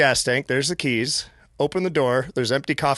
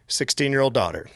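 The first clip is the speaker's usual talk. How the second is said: usual speaking voice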